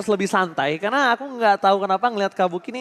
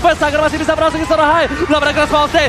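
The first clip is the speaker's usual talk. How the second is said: fighting game announcer; 8 semitones higher, 7 dB louder than usual. Acted. Fighting game announcer-style calls.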